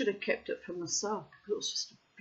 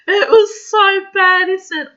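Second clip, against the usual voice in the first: laughing; 11 semitones higher, 16 dB louder than usual. Real, not acted. Laughing while retelling.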